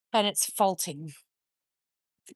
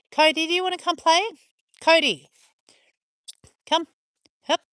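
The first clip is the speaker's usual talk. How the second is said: louder, higher call across the yard; calling the dog; 7 semitones higher, 6 dB louder than usual. Real, not acted. Owner calling Cody to come play, raised call.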